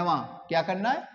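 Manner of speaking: usual speaking voice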